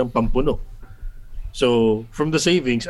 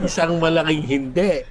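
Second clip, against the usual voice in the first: laughing; 4 semitones higher than usual. Real, not acted. Laughing admission of not budgeting.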